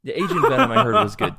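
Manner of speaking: laughing while talking. Real, not acted. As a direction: talk through a laugh. Laughing through the line.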